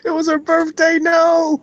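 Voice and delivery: high, laughing protest; laughing disbelief. Real, not acted. Friend reacting to the insult, laughing high. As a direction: squeal it through laughter. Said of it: "laughing disbelief".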